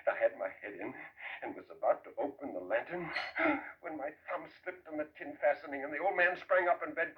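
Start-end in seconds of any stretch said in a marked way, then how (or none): 3.2-3.8 sudden high startled intake of breath; a frightened gasp in the dark; sharp gasp. Acted. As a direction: catch your breath as the lantern slips. Thumb slips on the lantern.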